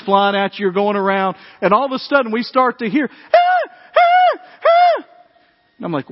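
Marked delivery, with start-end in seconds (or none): 3.1-5.1 very high mock screams of a kid on a ride; retold roller-coaster scream. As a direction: shriek way up high like a thrilled child.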